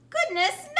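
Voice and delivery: plain reading voice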